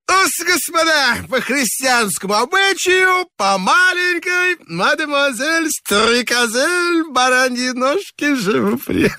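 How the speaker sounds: high, slurred playful toast; a carouser toasting the company; drunken toast. A narrator's reading. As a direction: raise a glass like a tipsy reveller. Drunken toast next door.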